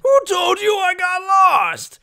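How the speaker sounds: indignant yell. Acted. Ganju yelling back at his sister. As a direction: fire it back at her.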